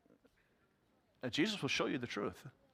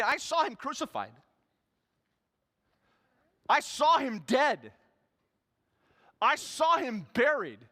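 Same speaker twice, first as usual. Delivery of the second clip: doubting Thomas; 12 semitones higher, 5 dB louder than usual. Acted. Thomas telling his friends he saw Jesus dead, high and loud. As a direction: refuse it, he saw the body.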